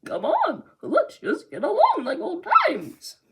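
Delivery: a hammed-up giant laughing villainess; Big Mom impression. Trying a Big Mom impression, hammed-up laughing shriek. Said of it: "I can't do a Big Mom impression, but I'll try".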